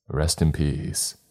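ordinary delivery

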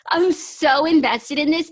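high, loud burst broken by laughter; emotional. Real, not acted. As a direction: overcome and laughing at yourself, pitch jumping up. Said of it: "I'm getting emotional".

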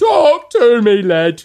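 mock British song. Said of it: "British version".

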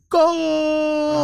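drawn-out high holler; mock scream. Retelling a fan's scream at the game, mock scream. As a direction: imitate a screaming fan, way up high. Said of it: "he screams like".

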